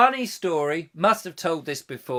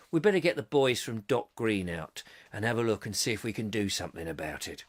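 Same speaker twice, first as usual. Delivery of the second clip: low, much softer plodding copper's tale; village bobby; 5 semitones lower, 8 dB quieter than usual. Retold or imitated. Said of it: "he would go".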